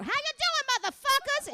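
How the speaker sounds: very high, loud brash greeting; brash shout. Retold or imitated. Showing the crowd how she talks, loud brash yell. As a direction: bellow it at the crowd. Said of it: "I talk like a blau".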